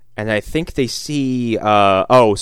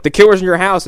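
raised, high imitation of alarm; mock alarm; 7 semitones higher, 6 dB louder, faster than usual. Host voices friends warning Devon Sawa, mock alarm. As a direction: announce it high and loud, as retold alarm. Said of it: "and they're like".

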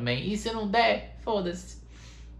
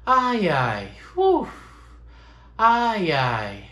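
mantra sighs; 3 semitones higher, 5 dB louder, much slower than usual. Shown as a demonstration. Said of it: "É um mantra".

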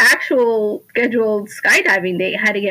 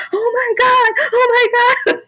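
retold panicked scream; 3 dB louder than usual. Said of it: "I was, like, screaming".

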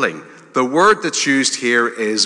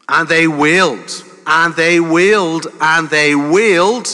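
building emphatic repetition; 5 semitones higher, 5 dB louder than usual. Acted. Repeating the Israelites' wailing.

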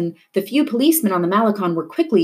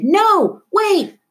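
much higher, loud pleading call; calling after her; 13 semitones higher, 5 dB louder than usual. A narrator's reading. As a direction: shout to stop her running off. Grandfather calls after fleeing girl.

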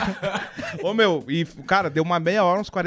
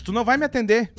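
put-on pitched-up voice of a cranky old customer; indignant old lady; 6 semitones higher than usual. Old lady complaining she's not being served, indignant and higher.

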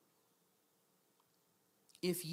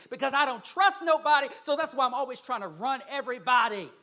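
mock defiant voice; 5 semitones higher, 10 dB louder than usual. Voicing a controlling person's excuse.

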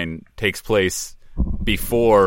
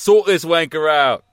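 mock Cockney, pitched up; Cockney accent; 6 semitones higher, 5 dB louder, slower than usual. Co-host repeats the actor's line in imitation Cockney. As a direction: put on an imitation Cockney for the quote. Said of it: "he's trying out a Cockney accent".

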